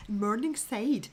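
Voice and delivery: drawn-out, very posh Scots "Morningside"; posh Morningside Scots, stretched out; posh Scots accent. Shown as a demonstration. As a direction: say "Morningside" slowly in a refined Edinburgh accent. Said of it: "That's very posh Scots".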